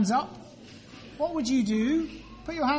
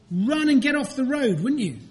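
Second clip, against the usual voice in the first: ordinary talk, nothing marked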